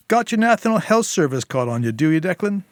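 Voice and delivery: sarcastic jab. Acted. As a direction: needle him with sarcasm. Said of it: "Sil chimed in".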